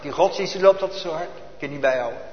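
normal talking voice

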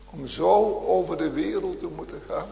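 ordinary delivery

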